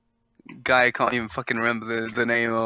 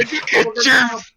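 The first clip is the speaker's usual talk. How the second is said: hillbilly meme voice; 9 semitones higher, 4 dB louder than usual. Retold or imitated. South Park meme quote.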